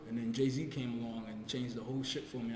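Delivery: ordinary talk, nothing marked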